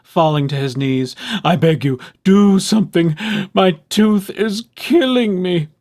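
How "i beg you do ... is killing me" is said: begging in pain. A narrator's reading.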